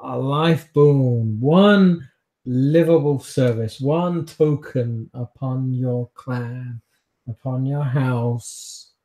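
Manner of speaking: slow, measured swearing of a pledge; solemn oath. Acted. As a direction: intone each phrase slowly as a vow. Captive made to swear an oath.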